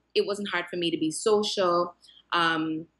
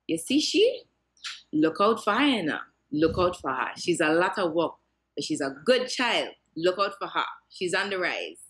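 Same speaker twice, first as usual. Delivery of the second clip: put-on Caribbean-accented grandmother, light and fond; a hint of Caribbean accent, affectionate; grandmother's island dialect. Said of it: "I will speak in my dialect, in the accent".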